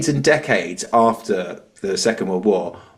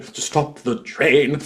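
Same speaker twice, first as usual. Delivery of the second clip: overly proper enunciation; 2 semitones higher, much slower than usual. Shown as a demonstration. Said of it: "proper English and enunciated".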